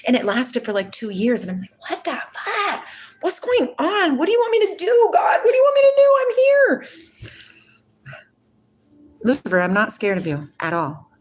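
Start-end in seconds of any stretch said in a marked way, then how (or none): 1.9-6.9 high, rising, breaking into a cry; desperate cry. Retold or imitated. Retelling her desperate plea to God, crying out high.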